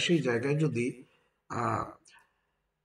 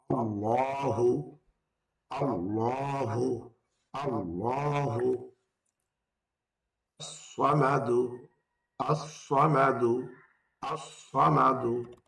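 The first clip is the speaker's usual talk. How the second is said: slow, careful teaching recitation; drawn out word by word for learners; much slower than usual. Shown as a demonstration. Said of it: "একটি করে বাক্য উচ্চারণ শিখি". (ধীরে ধীরে শিক্ষকের তিলাওয়াত)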